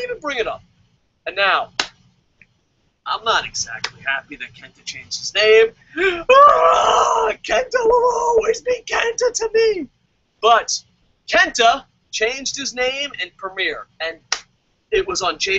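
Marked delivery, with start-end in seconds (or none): 6.0-9.9 gasp, then much higher wailing cry; overacted sobbing loyalty; mock heartbroken fan.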